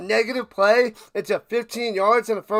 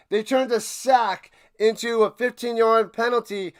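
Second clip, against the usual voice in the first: ordinary talk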